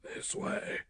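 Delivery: whispered direction; quiet. A narrator's reading. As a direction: say it in a hushed whisper. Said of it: "Uncle Ben said quietly".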